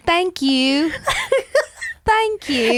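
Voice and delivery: high, mock-polite British thank-you; British accent. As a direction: imitate an accidental British accent, pitched high. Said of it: "my British accent came out".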